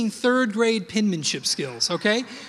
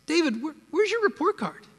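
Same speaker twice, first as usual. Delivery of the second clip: a higher, insistent mom asking again and again; nagging mother; 7 semitones higher than usual. Retold or imitated. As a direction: pester like a mother every week. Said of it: "my mother".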